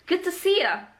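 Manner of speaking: 'Good to see ya' linked, pitched high; high, casual linked 'Good to see ya'; reduced form. Shown as a demonstration. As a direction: run 'Good to see ya' together with a short 'ya'. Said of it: "To say".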